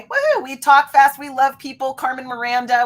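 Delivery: high, loud whoop into excited chatter; hammed-up party excitement; giddy enthusiasm. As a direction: play a bubbly people person, loud and high. Acting out high-I people people.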